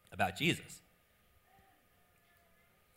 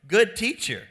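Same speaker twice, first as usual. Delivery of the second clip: high, loud, fawning "Good teacher"; butter him up, big and high; the man fawning over Jesus, high and loud; flattering "Good teacher"; 10 semitones higher, 10 dB louder than usual. Acted. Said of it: "fronts loads his question with".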